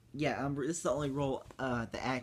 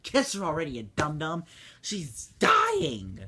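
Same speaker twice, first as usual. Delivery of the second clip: loud, fed-up mock yell; exasperated; 4 semitones higher, 8 dB louder than usual. Kid telling the prince to hurry, loud and exasperated. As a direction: shout it at the screen, rolling eyes. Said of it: "And I was like".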